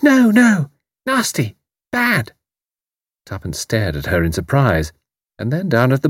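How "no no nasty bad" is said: high squeal, slowing on nasty, bad; squealing toddler. A narrator's reading. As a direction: squeal like a fussy little girl. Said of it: "a squeal from Betty".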